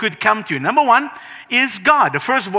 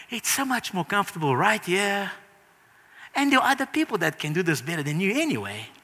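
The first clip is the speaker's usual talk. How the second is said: softer, coaxing inner voice; lazy inner voice; 7 dB quieter, faster than usual. Retold or imitated. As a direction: lure yourself back to comfort, softly. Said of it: "my voice comes in and says".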